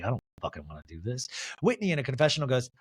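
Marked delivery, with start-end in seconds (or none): 0.0-1.3 much lower, much softer and fast grumble; grumbling. Retold or imitated. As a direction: mutter it under your breath, fed up. Mary grudging about drag contest.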